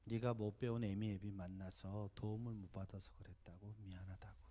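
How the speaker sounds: soft whisper, fading to almost nothing; mother apologizing to her son, whispered; mother's whispered apology. Retold or imitated. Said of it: "오히려 미안하다 그러셨어요".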